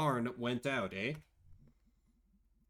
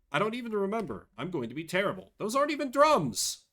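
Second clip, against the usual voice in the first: raised voice building to a high, loud outcry; flustered protest; 7 semitones higher, 5 dB louder, faster than usual. Acted. May dreading playing bass, flustered outburst. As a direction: protest harder with each phrase.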